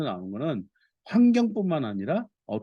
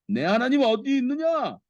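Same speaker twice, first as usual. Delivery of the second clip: high, raised-voice scripture taunt; jeering taunt; 12 semitones higher, 3 dB louder than usual. A narrator's reading. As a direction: throw it out as the enemies' mocking challenge. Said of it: "하고 빈정대니 그 조롱 소리가". (높은 목소리로 빈정대는 조롱)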